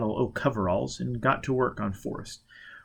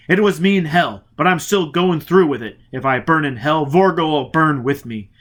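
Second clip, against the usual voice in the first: louder, defiant vow; angry outburst; 4 semitones higher, 7 dB louder than usual. Acted. Foyle vowing to Robin that Vorga will burn, louder and angry. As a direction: spit out the revenge oath. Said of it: "Foyle burst out angrily".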